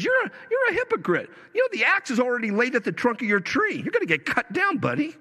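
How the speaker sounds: high, fast, scolding prophet voice; fiery John the Baptist. Retold or imitated. John the Baptist rebuking the Pharisees, high and fast. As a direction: rail at the Pharisees like a wild prophet. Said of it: "that's how he was talking".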